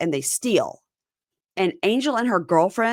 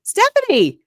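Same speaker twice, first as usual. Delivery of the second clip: much higher, louder mocking call-out; sarcastic call-out; 8 dB louder than usual. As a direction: squeal at her in disbelief. Mocking Stephanie's hypocrisy.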